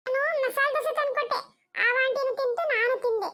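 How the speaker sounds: put-on high, slow child's voice, giggling as she confesses; child Chinnu confessing about the dosa in a high, giggly voice; little girl voice. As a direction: blurt out the secret like a small child. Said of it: "ಏಯ್, ಹೇಳ್ತೀಯ".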